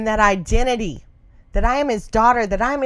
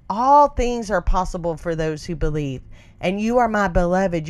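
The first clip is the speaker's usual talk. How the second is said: ordinary talk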